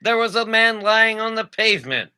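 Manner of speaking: high, loud put-on mid-Atlantic voice; mid-Atlantic accent. Reading definitions to co-host, hammy mid-Atlantic accent.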